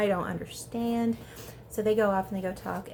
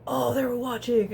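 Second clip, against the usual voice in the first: louder mock-bro exclamation; big "oh" of dawning realization; 3 semitones higher, 5 dB louder than usual. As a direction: imitate guys reacting loudly, falling at the end. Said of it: "they're very bro-y about it".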